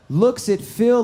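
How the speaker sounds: ordinary talk, nothing marked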